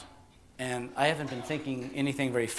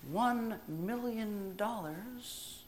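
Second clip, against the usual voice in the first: very soft, slow and drawn out; savoured fantasy; 6 semitones higher, 10 dB quieter, much slower than usual. Acted. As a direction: linger on each word in a hushed voice. Savouring a tempting wish.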